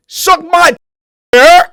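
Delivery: loud high New York shout; New York accent. Pretend. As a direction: yell it like a brash New Yorker. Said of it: "a heavy New York accent".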